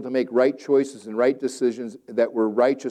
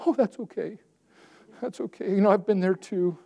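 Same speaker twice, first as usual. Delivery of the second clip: mock coddling pastor; 8 semitones higher than usual. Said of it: "I'm not gonna sit there and do that".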